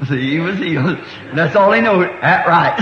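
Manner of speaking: chuckling all through; laughing. Real, not acted. Preacher delighted at the chief's simple faith, laughing. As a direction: let the laugh carry the words.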